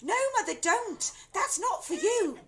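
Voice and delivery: high, loud, urgent pleading; alarmed daughter. A narrator's reading. Daughter begging mother not to drink. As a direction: beg her to stop.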